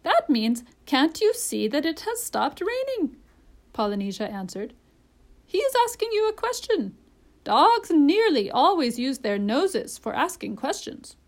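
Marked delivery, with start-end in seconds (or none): 0.0-3.2 high, emphatic bird voice; parrot, explaining. A narrator's reading. 5.4-11.2 high, loud bird voice; parrot, instructing. A narrator's reading.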